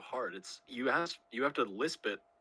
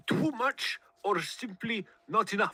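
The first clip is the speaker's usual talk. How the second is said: put-on high, slow lisped foreign accent; laboured philosopher caricature; Zizek; 9 semitones higher, 3 dB louder, slower than usual. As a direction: draw it out with a forced lisp. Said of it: "give me your best Zizek".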